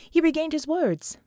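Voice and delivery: plain reading voice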